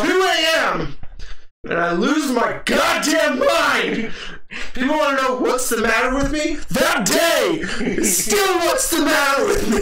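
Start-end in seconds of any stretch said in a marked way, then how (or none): 1.6-9.8 ranting shout. Retold or imitated. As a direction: let the old rage explode out. Ranting about melted ice cream.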